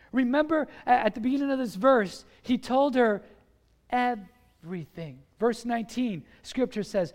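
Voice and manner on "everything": EVERYTHING slowly broken into syllables; dramatic slow emphasis. Real, not acted. Preacher reminds crowd Samson told all, dramatic slow emphasis. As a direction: stretch EVERYTHING out for weight.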